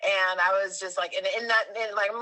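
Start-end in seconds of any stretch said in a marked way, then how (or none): none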